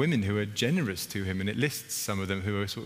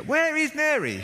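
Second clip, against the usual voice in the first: very high, loud, drawn-out wail for Mary; the last straw, stretch it out; a stressed sister cracking in the kitchen; frustrated Martha; 4 dB louder, slower than usual. Retold or imitated.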